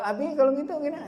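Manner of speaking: high, lightly voiced child; child asking. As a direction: mimic a kid asking his dad. Anak bertanya kepada ayah. (anak bertanya ke ayah dengan suara tinggi)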